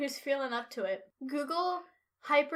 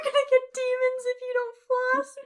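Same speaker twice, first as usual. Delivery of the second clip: laughing mock dentist; 11 semitones higher, 6 dB louder than usual. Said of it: "Me as a dentist".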